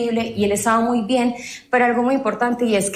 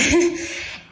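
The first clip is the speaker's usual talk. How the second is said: short breathy laugh; 7 semitones higher than usual. Real, not acted. Laughs after reassuring partner.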